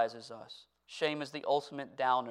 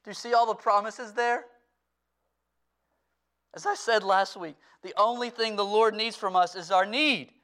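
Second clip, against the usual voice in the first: raised, louder preaching voice, pitched up; full-force preaching; 7 semitones higher, 6 dB louder than usual. Acted. Pastor urging his church to bring their past to Christ, full-force preaching. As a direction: preach it out to the whole room with passion.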